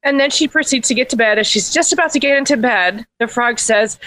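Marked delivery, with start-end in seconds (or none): none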